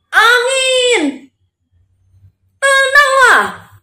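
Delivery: high, drawn-out shouted order; slow, ringing cry of authority; commanding. Acted. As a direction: call out a command to the wind. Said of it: "lagi kasih perintah".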